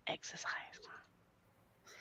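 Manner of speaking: slow breathy whisper; whispered exhausted sigh. Real, not acted. Echoing a friend's complaint.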